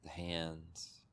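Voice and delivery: calm meditation guide, long pauses between phrases; unhurried, settling delivery, leaving space after each instruction; guided meditation. Real, not acted. Teacher guiding listeners to soften body and mind, guided meditation.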